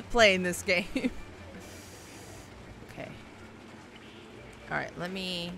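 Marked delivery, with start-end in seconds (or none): none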